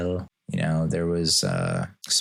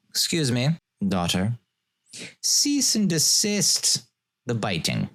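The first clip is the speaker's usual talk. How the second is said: a hint of stiff, formal decree, slowing on cease and desist; lightly hammed-up formality, stretching cease and desist; mock formal parent; 5 semitones higher than usual. Parent scolding a biting daughter, in mock formal tone. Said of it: "You're really being formal there".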